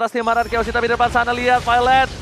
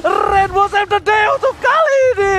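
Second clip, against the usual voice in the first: fighting game announcer; 7 semitones higher than usual. Acted. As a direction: call the teamfight at full hype. Esports caster hype call.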